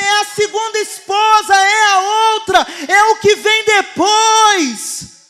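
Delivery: shouted exhortation, rising in intensity; full-force preaching. Real, not acted. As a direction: drive it home to the congregation at the top of your voice.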